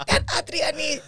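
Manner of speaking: slow, high, knowing put-upon drawl; exasperated. Retold or imitated. Shopkeeper grumbling about the nosy neighbour, exasperated. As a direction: draw the name out like an old grudge.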